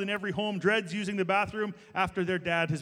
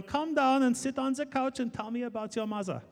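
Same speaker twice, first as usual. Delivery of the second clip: put-on higher-pitched German-accented psychoanalyst; Doctor Freud, German accent; 6 semitones higher than usual. Preacher playing analyst, inviting everyone onto the couch, comic German accent. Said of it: "to do a German accent".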